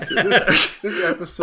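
cracking up at the blunder; laughing. Real, not acted.

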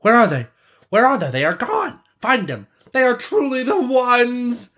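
high, anxious crowd cries; astonished crowd in a high voice; wonder and anxiety. A narrator's reading. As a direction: cry out high, amazed and alarmed. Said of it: "cried out in wonder and anxiety".

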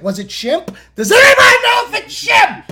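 high, fast loud laughing call; excited. Retold or imitated. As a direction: shout it out in giddy panic. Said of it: "I was like".

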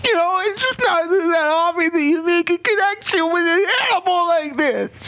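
high, hammed-up tearful voice; mock crying. Owner pretending to tear up about his parrot. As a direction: choke up over the bond with the bird.

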